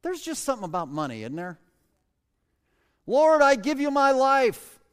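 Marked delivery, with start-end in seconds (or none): 3.1-4.8 much higher, louder, slow pious proclamation; grand mock prayer. Preacher mocking a churchgoer's big promise, hammed-up prayer. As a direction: declare it like a showy devotee.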